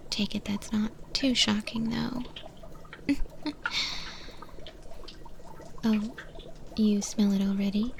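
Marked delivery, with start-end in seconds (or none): 2.3-5.8 breathy little laugh; quiet unvoiced giggle; soft whispered chuckle. Monster chef chuckles softly.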